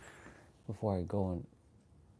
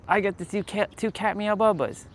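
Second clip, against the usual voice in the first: higher cooing pet talk; 8 semitones higher than usual. Real, not acted. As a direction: coo over the two kitties. Cooing at cats again.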